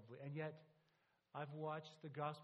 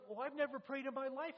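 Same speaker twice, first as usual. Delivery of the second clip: higher, louder exasperated objection; retold loud protest; 9 semitones higher, 8 dB louder than usual. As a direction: protest loudly that you cannot. Soldier protests he never prayed.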